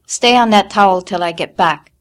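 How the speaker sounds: loud curt command; snapping. A narrator's reading. Mother snapping at bleeding daughter, curt. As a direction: snap the order. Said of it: "she snapped".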